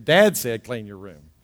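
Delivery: low DAD said, then a hushed command; dad's authority. Acted. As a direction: quote dad with weight, voice sinking. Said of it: "a little more weight to it".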